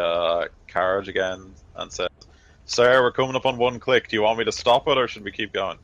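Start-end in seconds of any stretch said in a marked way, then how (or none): 2.7-5.8 raised, louder call to a superior; shouted report. Acted. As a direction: call out from outside the carriage. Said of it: "Angel just sort of shouts outside of the".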